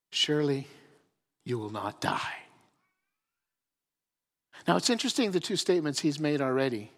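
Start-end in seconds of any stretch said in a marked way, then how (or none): 0.1-2.5 sly serpent. Acted. Serpent tempting Eve, low soft sly voice. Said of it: "and says these words".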